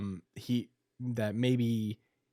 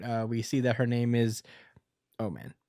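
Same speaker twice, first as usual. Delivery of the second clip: ordinary talk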